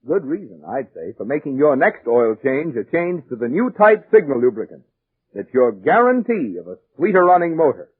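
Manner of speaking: normal talking voice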